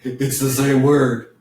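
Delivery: chuckle, then speak through it; amused, laughing at your own joke. Real, not acted. Teacher revealing the joke to the class, laughing.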